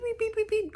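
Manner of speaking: high mimicked beeping; imitated phone beeps.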